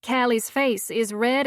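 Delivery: ordinary delivery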